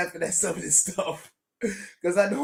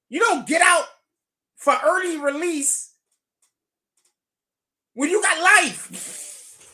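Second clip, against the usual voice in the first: loud, higher, slowing at the end; incredulous yell; 9 semitones higher, 4 dB louder, slower than usual. Real, not acted. Streamer yelling at chat that lifers don't get out. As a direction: yell it, stretching the last words.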